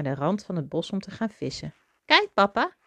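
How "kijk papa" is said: high, loud happy call; merry. A narrator's reading. Little mouse to his father while fishing, high merry call. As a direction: call out gleefully, without a real laugh. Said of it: "lachte Toby".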